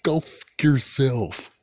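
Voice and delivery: slow, mocking middle-finger taunt; drawn-out taunt at Sony; mock defiant. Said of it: "to say".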